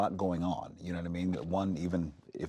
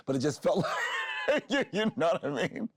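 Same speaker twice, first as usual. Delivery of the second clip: high, loud burst of laughter through words; laughing; 11 semitones higher, 4 dB louder than usual. Real, not acted. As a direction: break up laughing as you speak. Laughs while recalling a thrilling scene.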